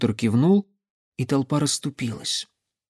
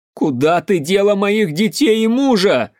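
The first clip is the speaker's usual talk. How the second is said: high, loud angry shout; accusing shout; 7 semitones higher, 5 dB louder than usual. A narrator's reading. Armed mother demands her children and husband, shouting. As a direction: shout the accusation at full pitch. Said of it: "закричала она".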